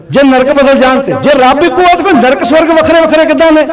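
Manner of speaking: high, loud rhetorical challenge; forceful preacher's challenge, pitched high; impassioned preaching. Real, not acted. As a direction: fire the question at the congregation.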